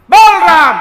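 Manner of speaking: loud, high commanding shout; angry shout. Acted. Guru to stubborn atheist son, shouting the command. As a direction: bark the order at the stubborn boy.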